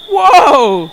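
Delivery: long, high, loud whoa; big hammed-up startled shout; startled yell. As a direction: cry out in mock alarm. Startled reaction acted in story.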